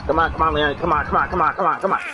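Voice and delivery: high, tense, rapid urging; urgent pleading. Real, not acted. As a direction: beg the character to make it. Urging character Leon during the jump.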